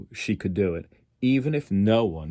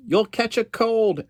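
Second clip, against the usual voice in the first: high, fussy aunt's warning; 13 semitones higher, 4 dB louder than usual. Acted. Aunt Patsy warning wading Phyllis, high fussing voice. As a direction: fret at her like a worried aunt. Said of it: "Aunt Patsy warned".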